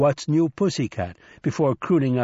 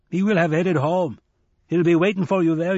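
put-on bright, higher old trickster voice; Nancy, raised and playful; lively old man; 6 semitones higher, 5 dB louder than usual. Nancy reassuring Shadow.

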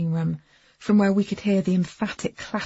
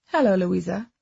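put-on Eastern European accent; 4 dB louder than usual. A narrator's reading. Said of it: "held an Eastern European lilt".

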